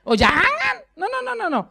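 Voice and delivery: high, insistent no no no; rising alarmed denial; emphatic no. Real, not acted. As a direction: wave the idea away firmly. Emphatic refusal of stereotype.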